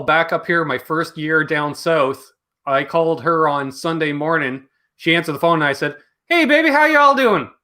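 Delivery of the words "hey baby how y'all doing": high, loud Southern greeting; Southern accent. Retold or imitated. Returning traveler phoning home to girlfriend, Southern accent. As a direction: put on a picked-up Southern twang, pitched way up. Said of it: "That accent better be left at the border".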